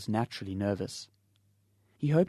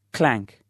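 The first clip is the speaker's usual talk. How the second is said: spoken clank; 7 dB louder than usual. Acted. Basin reaches floor.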